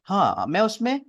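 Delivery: ordinary talk, nothing marked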